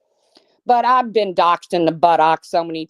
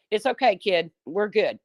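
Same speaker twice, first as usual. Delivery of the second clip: usual speaking voice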